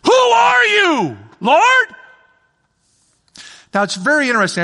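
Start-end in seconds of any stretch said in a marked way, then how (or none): 0.0-1.9 high, slow, loud cry; Paul shouting up at the voice; awestruck shout. Acted. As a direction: cry out to the sky in awe. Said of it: "two ways he could say this".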